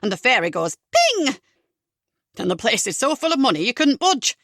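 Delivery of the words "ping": very high, loud ping; magic ping. Acted. Comedian's fairy making magic, very high ping.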